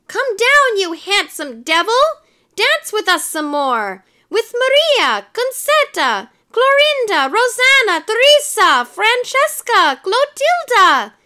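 high, loud clamoring crowd; squealing roll call of admirers; crying out. A narrator's reading. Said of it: "they cried".

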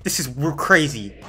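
normal talking voice

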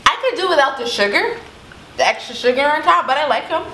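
talking while chewing candy; words slightly muffled by food; mouth full, chewing. Real, not acted. Seen 1: Woman holds small candy at her lips, mouth working between words.

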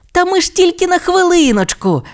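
very high little dinosaur voice; crying out. Acted. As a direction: call back over your shoulder, high-pitched. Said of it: "закричав Тіммі".

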